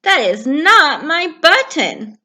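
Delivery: mildly cross Toad; annoyed Toad. Acted. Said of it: "said Toad".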